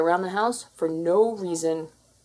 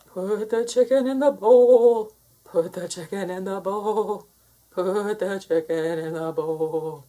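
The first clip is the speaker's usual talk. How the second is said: bossy demand. Acted. Expert cat ordering its human to serve chicken, bossy. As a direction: order it, then grind it out.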